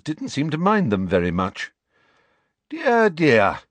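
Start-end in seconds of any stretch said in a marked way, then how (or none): 2.7-3.6 high, dry sardonic voice; drily mock dismay; McGonagall sardonic. A narrator's reading. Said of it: "said Professor McGonagall sardonically".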